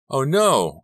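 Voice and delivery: high, loud cry of alarm; sudden shocked "Oh no!". A narrator's reading. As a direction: cry out as the disaster hits. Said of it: "I cried".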